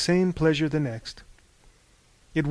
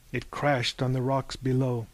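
usual speaking voice

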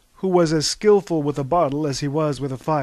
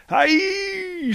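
all scream it together; high group yell. Acted. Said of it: "screamed the others".

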